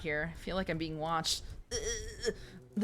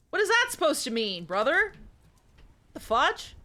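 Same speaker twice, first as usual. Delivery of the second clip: put-on higher, louder eager young hero voice; Ash Ketchum voice; 7 semitones higher, 3 dB louder than usual. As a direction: play the kid hero, indignant. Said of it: "That guy got my, my Ash Ketchum voice".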